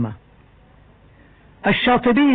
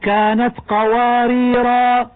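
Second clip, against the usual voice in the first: slow chant with a long final alif; slow recitation; Quran recitation; 2 semitones higher, slower than usual. Shown as a demonstration. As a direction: recite قواريرا with a held alif. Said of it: "من قوله تعالى".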